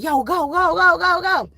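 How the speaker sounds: high-pitched, hurried urging; acted-out plea to the getaway driver to move; urgent getaway call.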